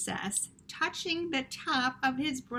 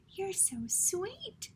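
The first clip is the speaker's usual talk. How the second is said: high, soft and slow princess voice; sweet princess; 10 semitones higher, 6 dB quieter, slower than usual. Acted. Princess speaking to the tiny mouse, high and cooing. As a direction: coo it like a gentle princess. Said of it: "said the princess".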